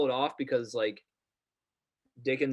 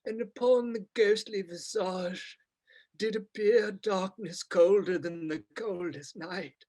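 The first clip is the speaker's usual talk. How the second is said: hammy stage actor; 5 semitones higher than usual. Retold or imitated. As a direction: ham it up like an old stage thespian. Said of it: "weird British thespian voice".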